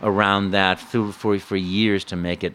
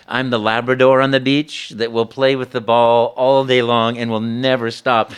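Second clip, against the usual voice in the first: ordinary talk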